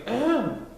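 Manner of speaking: throat clearing. Acted. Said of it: "cleared his throat carefully".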